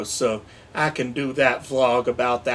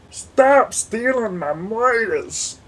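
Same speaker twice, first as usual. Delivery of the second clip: silly voice; 7 semitones higher, 5 dB louder, slower than usual. Pretend. Put-on whiny voice.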